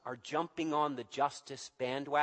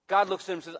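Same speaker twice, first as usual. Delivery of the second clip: ordinary delivery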